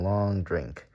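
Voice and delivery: usual speaking voice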